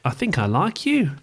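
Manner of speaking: "you" lifted like a question; statement turned question, pitch rising on "you"; rising inflection. Shown as a demonstration. Showing Australian uptalk to co-host, rising on "you". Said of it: "you just rise at the end".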